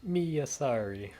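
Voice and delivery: high, slow, hammed-up Kararagi accent; poor Kararagi accent. Subaru protesting Rem's harsh verdict in a poor Kararagi accent. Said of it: "stops with the poor accent".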